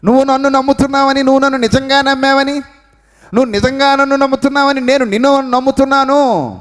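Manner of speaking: high, urgent pulpit cry; preacher giving God's words to the congregation, high and urgent; fervent preaching. Acted. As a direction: press it home to the listeners. Preacher voices God, high and fervent.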